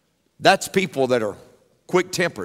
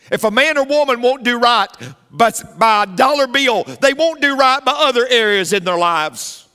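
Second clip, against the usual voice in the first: much higher, louder, pounding out each phrase; preaching at full force; 6 semitones higher, 4 dB louder than usual. Acted. Pastor warns the congregation about angry people, preaching at full force. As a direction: drive it home like a fiery Baptist sermon.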